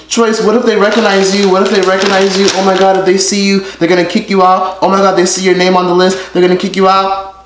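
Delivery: high, anxious mock fan voice; mock worried fans. Vlogger mimicking worried fans, high and panicky. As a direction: panicky imitation of fretting viewers. Mocking fans' worried questions.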